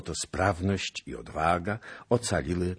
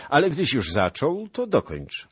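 commanding prince; 10 semitones higher, 7 dB louder than usual. A narrator's reading. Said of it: "rzekł książę".

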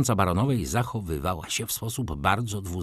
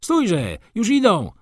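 higher, louder urgent call; a student calling after his friend, louder and higher; 9 semitones higher, 4 dB louder, slower than usual. A narrator's reading. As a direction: shout to a friend to stop. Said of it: "zawołał pan Maleski".

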